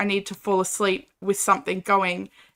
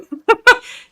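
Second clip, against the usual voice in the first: high delighted laugh; 13 semitones higher, 8 dB louder than usual. Real, not acted. Delighted squeal at snake.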